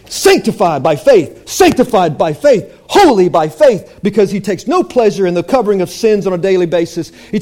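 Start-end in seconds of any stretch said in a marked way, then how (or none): none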